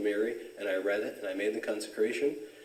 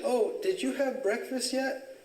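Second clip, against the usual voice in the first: imitated young woman, very high and slow; a high, solicitous girl's question; Blanche; 12 semitones higher, slower than usual. Girl asking after him at work, high imitated concern. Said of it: "she said".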